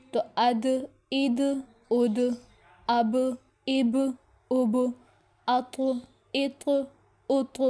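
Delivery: اد اب اط each closed with a bouncing echo on the final letter; qalqala bounce. Shown as a demonstration. Teacher drilling qalqala on dal, ba and ta. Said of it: "اس طرح سے کر کے ہم ان کو ادا کریں گے".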